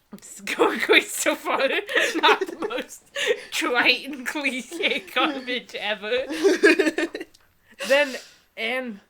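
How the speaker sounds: amused sarcastic disbelief; laughing. Real, not acted. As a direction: laugh while mocking. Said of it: "laughing at book description".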